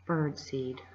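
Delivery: low, soft grumble; muttered. Acted. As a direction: mutter it under your breath in disgust. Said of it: "Ben Ali muttered".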